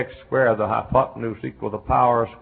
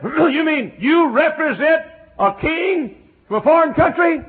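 very high, loud and slow; astonished man; 11 dB louder, slower than usual. Retold or imitated. Chemist amazed he sits by an ambassador, very high and slow. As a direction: stretch it out in disbelief. Preacher voices the man on the plane.

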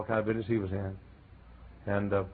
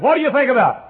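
high, loud curious voice; man on the plane; 11 dB louder than usual. Retold or imitated. Preacher voicing the chemist on the plane, high and loud. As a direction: pitch him up high and bright with interest.

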